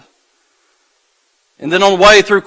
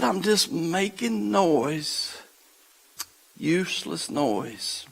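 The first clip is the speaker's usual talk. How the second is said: very soft, slow and deliberate; hushed conclusion; 15 dB quieter, slower than usual. Real, not acted. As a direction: drop to a hush and let it sink in. Hushed payoff after the build.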